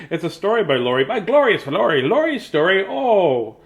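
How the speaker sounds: high, loud rhyming silliness; giddy playful wordplay; playful, laughing. Real, not acted. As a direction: revel in the silly rhyme through laughter. Playing with the accidental rhyme, laughing.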